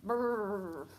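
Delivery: a shivery lip-trill brrr; shivering brrr. Shown as a demonstration. Teacher shows a child a cold snowman's brrr. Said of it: "Like you're really cold".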